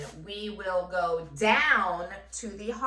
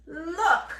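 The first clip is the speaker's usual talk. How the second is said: playful high look; 9 semitones higher, 5 dB louder than usual. Acted. Playful lead-in to the word.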